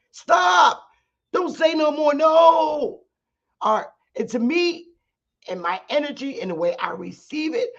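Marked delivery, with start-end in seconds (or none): 0.1-3.0 high, loud acted outcry; shouted protest. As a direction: cut them off with a drawn-out high 'no'. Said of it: "It's almost like".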